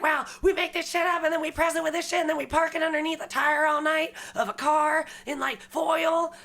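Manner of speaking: high, rambling imitation of a crackhead; mock crackhead. Friend retelling a crackhead's story, high mock voice.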